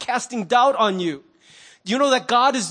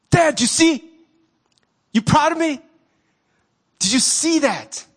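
put-on higher, louder, a kid begging dad to notice; eager teen calling dad; 7 semitones higher, 3 dB louder than usual. Teen player calling to his father from the court, eager and high. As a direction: call out to your father, hungry for his approval.

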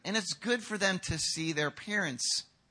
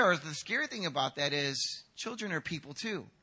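ordinary delivery

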